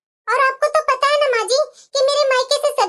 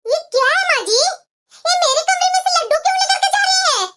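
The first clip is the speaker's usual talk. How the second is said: raised, higher-pitched indignant voice; angry scolding; 4 semitones higher than usual. Acted. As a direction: confront her over something taken from you. Daughter-in-law scolds over taken laddus. (गुस्से में ऊँची आवाज़ में डाँटते हुए)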